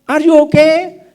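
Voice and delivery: mock English question. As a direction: ham up a parent trying English. Parent asks child in English.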